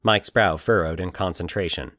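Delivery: plain reading voice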